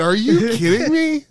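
loud, high mock outrage; incredulous. Storyteller replaying his reply to the waiting driver, loudly incredulous. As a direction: act out indignant disbelief. Retold argument at car window.

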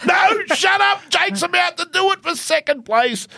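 high, sharp shout to hush someone. Retold or imitated. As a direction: yell it, high and impatient. Cheryl yelling at Dan.

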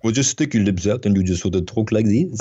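mock French with lips stuck out; mumbled French accent. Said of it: "sounds like people are mumbling".

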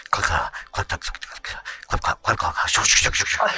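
soft clicking hoof sounds; clicking like horse hooves. Retold or imitated. Badger clip-clopping in wooden sandals.

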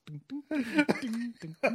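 high-pitched sung-out dings; cartoon dinging. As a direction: imitate a little parade bell, high. Imitating a cartoon parade of Borats.

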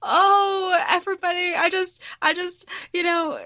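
high, breathless laughter through the words; words squeezed out between giggles; laughing. Real, not acted. Host laughing helplessly at the book's plot. Laughing fit after a twist.

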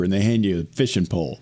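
normal talking voice

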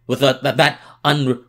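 ordinary talk

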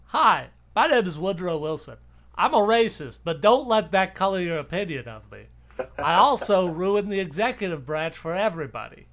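a cheery mock self-introduction; Woodrow Wilson voice.

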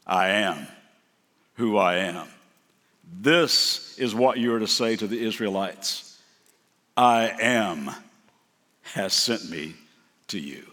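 low, slow, solemn divine voice; voice of God. Acted. God answering Moses at the mountain, low and solemn. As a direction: speak as God, deep and measured. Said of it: "And God says to Moses".

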